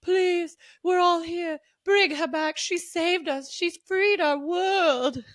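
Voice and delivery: high, drawn-out hammed-up plea; mock begging. Pleading with everyone to bring her back, mock begging. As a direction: plead dramatically, stretching the last words. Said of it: "begging everybody".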